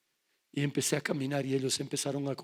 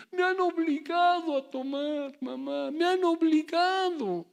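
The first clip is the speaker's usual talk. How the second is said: put-on high, loud and slow, a whining drunk boy; mock drunk son pleading; 12 semitones higher, 6 dB louder, slower than usual. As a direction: stretch it out like a son begging his mother's pity. Drunk son claims forced drinking.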